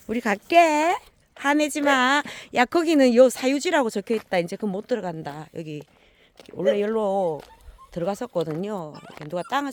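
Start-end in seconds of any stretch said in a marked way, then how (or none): 0.1-4.4 loud high coaxing; loud high coaxing to a dog; talking to the dog. Real, not acted. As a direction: soothe the angry dog loudly and higher.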